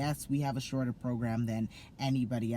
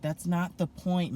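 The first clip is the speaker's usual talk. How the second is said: mild retort; 6 semitones higher, 4 dB louder than usual. Retold or imitated. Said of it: "I'm like".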